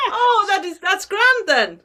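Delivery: high, relieved Irish leprechaun; Irish accent. Acted. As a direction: sound relieved in a put-on Irish brogue. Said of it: "do the accent that I can't do".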